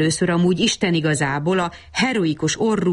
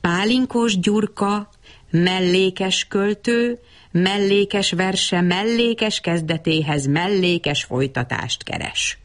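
slow grand introduction; 3 semitones higher, slower than usual. Acted. Poet introduces himself grandly.

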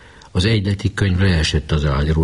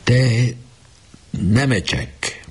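high, drawn-out trembling call of the name; a boy calling his dying captain, voice trembling; 7 semitones higher, much slower than usual. A narrator's reading. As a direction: call the sick friend's name with a catch in the throat. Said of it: "remegő hangon kezdte".

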